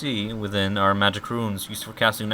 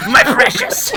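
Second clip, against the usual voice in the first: high, loud Gollum hiss; 12 dB louder than usual. Retold or imitated. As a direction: squeal it like a greedy Gollum. Gollum quote about cursed book.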